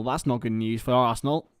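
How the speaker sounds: usual speaking voice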